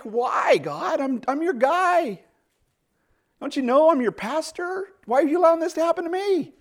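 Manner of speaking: a hurt servant demanding answers; indignant plea to God. Retold or imitated. Pastor complaining to God.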